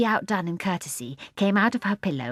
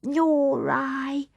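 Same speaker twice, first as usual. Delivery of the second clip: lightly voiced baby girl; 7 semitones higher than usual. A narrator's reading.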